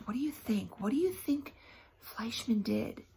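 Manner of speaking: ordinary talk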